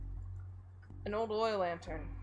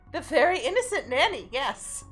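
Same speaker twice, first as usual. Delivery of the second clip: nanny voice; 6 semitones higher, 8 dB louder than usual. Acted. Voicing the nanny character.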